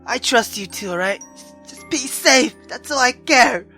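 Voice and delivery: usual speaking voice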